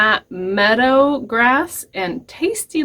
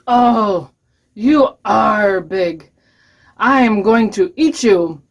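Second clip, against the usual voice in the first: troll voice; 4 dB louder than usual. Acted. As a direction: lean hard on each word as a menacing troll. Troll meets the big goat.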